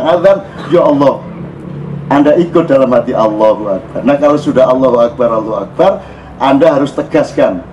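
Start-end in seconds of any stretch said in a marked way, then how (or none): none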